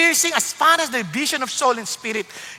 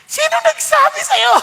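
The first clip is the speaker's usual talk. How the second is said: 1 mock challenge; 5 dB louder than usual. Mocking retort to complainers.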